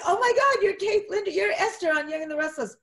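high, loud gushing fan; wife spotting her soap star; thrilled, rushing recognition; starstruck fan. Retold or imitated. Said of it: "first his wife said".

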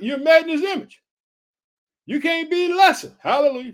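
loud, high, fervent exhortation; preaching shout. Real, not acted. Preacher telling believers they're made in his image, shouted exhortation. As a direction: lift it to a shouted hallelujah.

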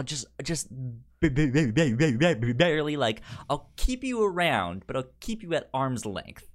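ordinary talk, nothing marked

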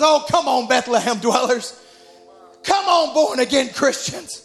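retold plea, lifting on "come on"; preacher imitating an urgent appeal; pleading. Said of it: "And he just pleads".

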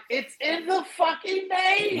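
high, slow, exasperated; mock exasperated squeal. Mocking the people triggered by his post, high and slow. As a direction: stretch it out high in disbelief. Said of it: "I'm like".

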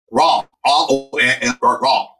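Deep South vowels, letters run together; mock Louisiana drawl; Deep South accent. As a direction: imitate a Southern kid spelling out his name. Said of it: "deep, deep South accent".

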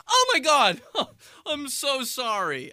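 high, breathy mock-sorry voice; shrill-startled stranger, hammed up; high mock apology. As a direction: squeal a fake startled apology. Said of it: "And they're like".

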